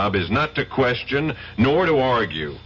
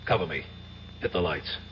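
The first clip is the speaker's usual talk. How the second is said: hushed orders; 4 semitones lower, 10 dB quieter than usual. Acted. Quiet orders during a raid.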